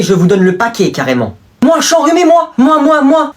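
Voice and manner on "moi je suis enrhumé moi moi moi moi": put-on high, loud begging kid; mock pleading. Pupil begging the boy for tissues, high and pleading. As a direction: beg like a needy child.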